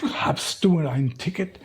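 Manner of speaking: mock stern ticket inspector; German conductor.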